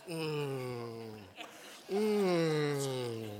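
long, low, falling "mmm"; savoring hum. Acted. Preacher acting out eating a barbecue rib, savoring hum. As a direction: hum with pleasure over a mouthful of food. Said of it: "I do this all the time when I eat barbecue".

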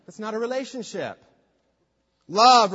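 ordinary talk, nothing marked

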